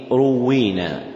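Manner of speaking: روينا with u after ra, doubled waw; first vowel u, then long ee in روينا; ruwwina pronunciation. Shown as a demonstration. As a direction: say روينا as "ru-WWEE-na". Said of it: "بضم الراء وكسر الواو مشددة".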